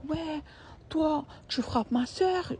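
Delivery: high, slow accusation; accusing partner. Retold or imitated. Partner blaming her for hitting his sister, slow high accusation. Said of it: "Il commence à dire".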